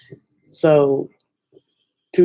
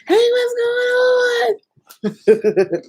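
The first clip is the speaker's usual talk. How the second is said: mock live greeting. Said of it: "just be like".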